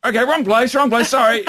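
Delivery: high-pitched hasty mock apology; flustered apology. Dad retreating from a stranger, flustered apology. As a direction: back out in embarrassment.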